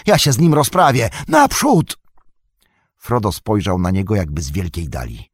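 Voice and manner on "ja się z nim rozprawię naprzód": urgent urging. Acted. Sam urging Frodo onward.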